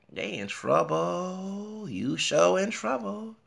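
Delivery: high, slow, drawn-out taunt; teasing singsong. Pretend. Teasing the station that's in trouble, singsong taunt. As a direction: tease them in a slow singsong.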